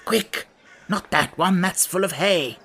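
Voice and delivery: urgent. A narrator's reading. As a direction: correct the helper urgently. King hurrying Hare at the bag.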